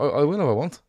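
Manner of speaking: ordinary delivery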